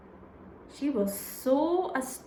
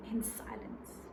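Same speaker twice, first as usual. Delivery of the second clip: whispered; 3 semitones lower, 15 dB quieter than usual. A narrator's reading. Narrating silent stare.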